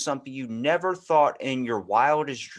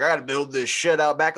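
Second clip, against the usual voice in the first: stepdad's mock gripe; 4 semitones higher, 6 dB louder than usual. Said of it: "He's like".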